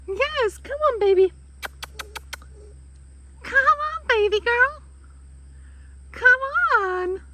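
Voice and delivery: high, sing-song coaxing to a dog; coaxing a pet. Real, not acted. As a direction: gently lure a frightened dog in. Calling frightened dog to come.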